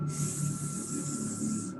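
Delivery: unvoiced hissing s; hissed s sound. Shown as a demonstration. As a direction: hiss the letter s.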